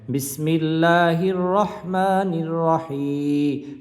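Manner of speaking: বিসমিল্লাহির রহমানির রহিম chanted slowly with হি stretched; slow melodic Quranic reading; drawn-out recitation. Shown as a demonstration. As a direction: hold the হি of রহিম one alif. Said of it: "এক আলিফ পরিমাণ টেনে পড়তে হয়".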